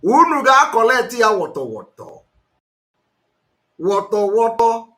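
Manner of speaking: high, loud taunting call. Real, not acted. Commentator taunting rival politicians, loud and high.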